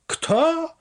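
sharp high cry of disbelief; disbelieving. A narrator's reading. Mother hearing her lost son's voice, high disbelief. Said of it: "powtórzyła uszom nie wierząc".

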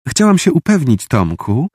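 put-on high, coaxing girl voice; sweet cooing. A narrator's reading. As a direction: coo it, all charm. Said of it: "zagruchała przymilnie". (przymilne gruchanie)

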